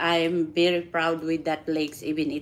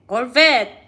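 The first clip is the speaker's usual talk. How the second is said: "Corvette." louder with a firm falling end; "Corvette." as a mock reprimand; teasing scold; 5 semitones higher, 7 dB louder than usual. Real, not acted. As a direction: call out "Corvette." firmly. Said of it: "make that meaning again".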